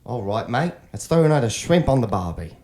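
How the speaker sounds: hammy Australian bloke voice; Australian accent. Acted. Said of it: "Yeah, let's hear it".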